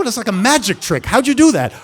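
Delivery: high and loud with rising wonder; awed by the expert; amazed. Retold or imitated. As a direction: gasp at a magic trick. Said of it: "I go".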